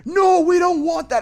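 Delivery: high, loud mock protest; imitating angry customer. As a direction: refuse like an outraged client. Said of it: "he's cussing me out in the email".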